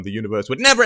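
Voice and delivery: ordinary talk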